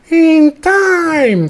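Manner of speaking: high, drawn-out wail of a phrase; mock mystical echo. Mocking the healer as if music swells, high wavy voice. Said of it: "cueing the wibbly wobbly music".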